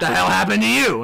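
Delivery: loud, irritated medic robot; Red Foreman. Acted. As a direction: berate the patient in exasperation. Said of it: "Red Foreman".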